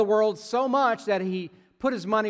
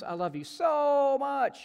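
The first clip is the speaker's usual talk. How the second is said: sweet text voice; 9 semitones higher, slower than usual. Retold or imitated. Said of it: "I even text her".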